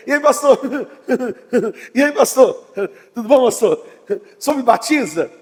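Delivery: chuckling through a cheery greeting to the pastor; laughing churchgoer. Retold or imitated. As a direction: laugh between the words, carefree and light. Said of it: "a pessoa vem sorrindo".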